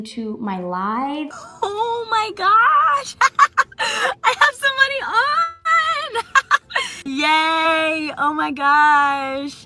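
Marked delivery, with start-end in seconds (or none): 1.6-9.7 high, loud, thrilled squealing; excited squeal. Retold or imitated. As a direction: squeal with delight. Reenacting someone joining the live.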